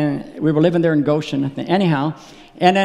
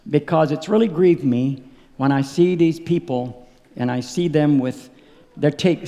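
ordinary talk, nothing marked

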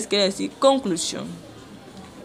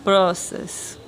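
slow, word-by-word model for the class; teacher dictating each word slowly; slow repeat-after-me; much slower than usual. Shown as a demonstration. Teacher models the question slowly for students to repeat. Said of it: "Entonces repitan después de mí".

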